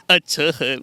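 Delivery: punchy actor impression with repeated little laughs; Nana Patekar. Comedian mimics a film star ranting about Jharkhand, mock-indignant impression. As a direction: rant in a film star's mannered voice, dropping away on the catchphrase. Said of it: "अगर झारखंड पे बोलते तो कैसे बोलते? नाना पाटेकर".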